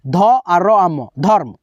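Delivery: ध और र आ म sounded out slowly, syllable by syllable; slow sounding out. Shown as a demonstration. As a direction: spell out ध और र आ म slowly for the class. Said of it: "रेफ की मात्रा का उच्चारण र पहले". (ध और र आ म धीरे-धीरे अलग करके)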